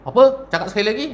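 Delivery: sharp high outraged question; affronted surprise. Acted. Husband shocked his wife's name is called, outraged high question. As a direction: snap back in high, shocked offence.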